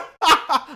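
shrieking laugh; a sudden high cackle; high burst of laughter. Real, not acted.

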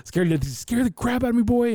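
high, strained frightened cry; scared dad. Retold or imitated. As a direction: sound shaken, voice pushed way up. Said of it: "He was scared".

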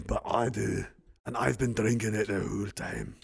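put-on Scottish brogue, pitched a little down; Scottish accent. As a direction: confide it as a hammy Scot. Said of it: "Ian hates my Scottish accent".

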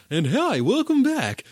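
high, loud mock radio host; over-the-top radio DJ hello; extra radio voice. Shown as a demonstration. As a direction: ham up a cheesy radio host greeting. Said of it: "extra radio voice".

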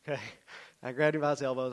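ordinary talk, nothing marked

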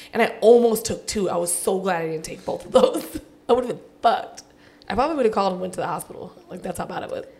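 normal talking voice

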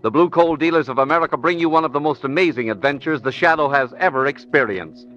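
booming vintage radio announcer; old radio announcer. Acted. Announcer reads disclaimer, promo.

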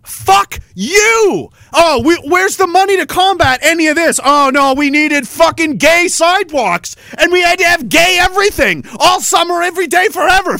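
real outrage shouted at the camera; yelling. Real, not acted. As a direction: shout it in disbelief. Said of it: "yell about it".

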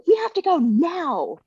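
high-pitched urgent order; urgent command. Retold or imitated. Mom telling her husband they must leave, high and urgent.

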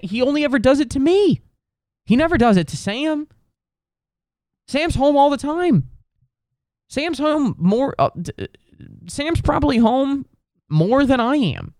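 high, incredulous complaint; exasperated whine. Real, not acted. Griping that cat only bothers him, high exasperated whine. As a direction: whine about it, pitched well up.

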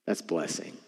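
normal talking voice

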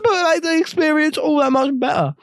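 talking round tablets in mouth; mouth full. Real, not acted. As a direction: mouth full, words blurred. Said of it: "I've got tablets in my mouth".

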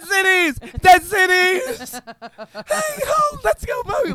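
high, loud excited chant of the song; thrilled kid. Retold or imitated. Host imitating Sean hearing the track, thrilled chant. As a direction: mimic a kid on Christmas shouting along. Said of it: "he's just sitting there like".

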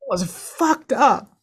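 high, emphatic condemnation; slow, high and heartfelt outrage; real upset. Real, not acted. Upset condemning what was done.

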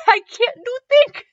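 high, squeaky laughing read; cracking up. Real, not acted. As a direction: reread it through helpless laughter. Cracks up at misworded line.

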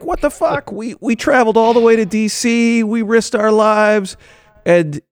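outraged mock rant. Mocking outraged Trump supporters.

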